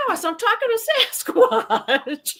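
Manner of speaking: high, laughing through the words. Real, not acted. He tells Shelly he's been talking to Sasquatch, laughing. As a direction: laugh in disbelief at yourself. Laughing at his own obliviousness.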